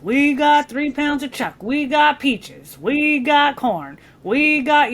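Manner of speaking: chef's slow singsong inventory; chef listing food. Acted. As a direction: stretch each item in a rolling, repetitive tune. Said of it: "naming all the meat".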